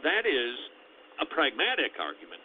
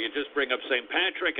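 ordinary talk

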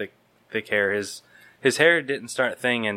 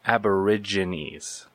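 said slowly; slower than usual. Shown as a demonstration. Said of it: "gotta say it slowly".

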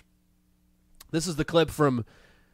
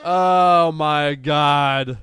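real exasperation, louder; exasperated oh my god; 8 dB louder, much slower than usual. Real, not acted. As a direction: groan it in disbelief. Exasperated reaction.

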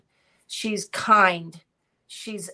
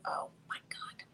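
voiceless under-the-breath whisper; breathless little whisper of frustration; whispered aside; 17 dB quieter than usual. Real, not acted. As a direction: whisper it to yourself while searching for the name. Can't recall the burger place.

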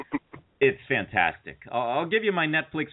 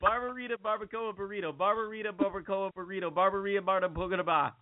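softer, high, steady run of "barbarita, barbacoa, burrito"; fast tongue twister; 5 semitones higher, 6 dB quieter than usual. Shown as a demonstration. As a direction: chant "barbarita, barbacoa, burrito" quickly under control, softly. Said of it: "Can you say that five times fast?".

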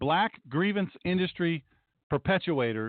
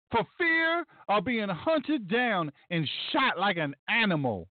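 mock impassioned speechifying, a little higher; impassioned TV guest; 4 semitones higher than usual. Host mimicking a guest on last night's show, mock fervour. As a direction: imitate a worked-up guest hammering each phrase. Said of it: "He just kept saying".

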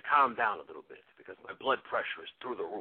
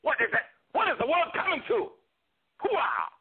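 much higher, louder ranting cry; indignant outburst; 3 dB louder than usual. Acted. Pacino impersonator exploding at the world to his host. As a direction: rant to the heavens then bark Hooah.

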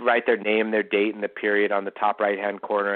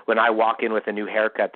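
normal talking voice

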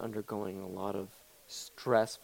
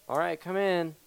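raised, higher call to the door; 6 semitones higher, 4 dB louder than usual. Pretend. Host answering someone at the door, calling out. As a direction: call a visitor in from across the room.

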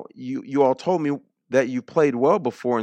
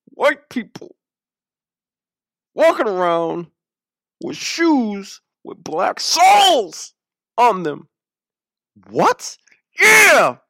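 hammed-up indignation, bursting high and loud; mock outrage; 7 semitones higher, 5 dB louder, slower than usual. Outraged man telling Penny about the shoes, mock indignant. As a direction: build to a high, loud, scandalised cry. Acting out outraged man's rant.

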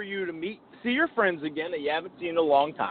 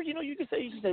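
ordinary talk

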